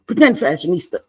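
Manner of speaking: usual speaking voice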